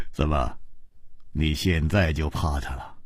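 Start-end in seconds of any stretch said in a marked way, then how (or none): none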